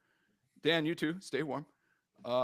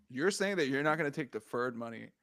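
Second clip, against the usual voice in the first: ordinary talk, nothing marked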